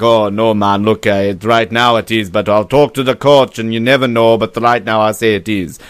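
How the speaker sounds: badly faked Jamaican accent; loose, amateur Jamaican lilt; terrible Jamaican accent. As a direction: do a clumsy Jamaican sprinter impression. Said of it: "That was a terrible Jamaican accent".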